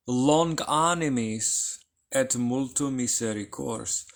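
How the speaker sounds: ordinary talk, nothing marked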